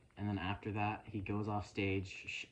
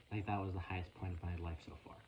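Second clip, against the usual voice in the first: usual speaking voice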